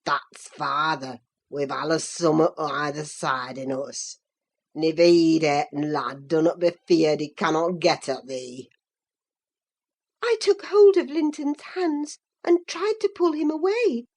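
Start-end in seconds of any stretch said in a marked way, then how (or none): 0.1-8.7 put-on deep, gloating old servant; croaking old man. A narrator's reading. Old servant crowing that the boy takes after father, croaking. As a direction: croak it out low in broad dialect. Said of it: "he cried".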